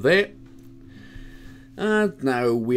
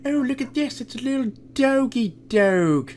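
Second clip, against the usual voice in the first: put-on high baby-talk voice; gushing at a puppy in a much higher voice; cooing at a dog; 13 semitones higher than usual. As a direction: coo over the little doggy.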